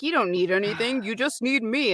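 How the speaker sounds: lightly voiced needy husband from an audiobook; audiobook husband's voice. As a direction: mimic a man's voice, lightly put on. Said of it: "You just did his voice, like, spot on".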